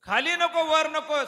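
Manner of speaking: high, loud mock of the demon's terms; loud mimicking. God repeats the demon's conditions, loud and mocking. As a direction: throw his own conditions back loudly. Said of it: "कुठं आहे तू, मांडीवर आहे".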